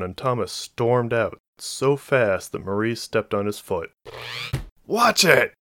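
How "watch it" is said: high, loud startled snap; snapped. A narrator's reading. Man recoiling from his stepped-on foot, snapping. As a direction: snap it out sharply, pitched up. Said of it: "Thomas snapped".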